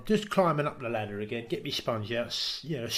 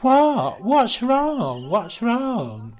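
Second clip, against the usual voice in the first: high, loud mock innocence; mock innocent what's wrong; 7 semitones higher, 5 dB louder, much slower than usual. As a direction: play dumb, squeaky. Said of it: "he goes".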